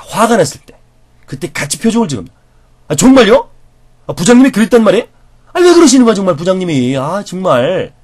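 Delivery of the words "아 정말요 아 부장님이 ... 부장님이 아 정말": high, loud, fast indignation on the listener's side; loud sympathetic outrage at the boss; shared anger. Shown as a demonstration. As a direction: get angry with them. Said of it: "이렇게 같이 화를 내주는".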